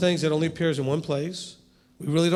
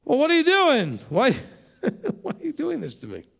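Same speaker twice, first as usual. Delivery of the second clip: mock indignant complaint; 8 semitones higher than usual. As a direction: play Samuel as put out at being disturbed. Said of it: "And he's like".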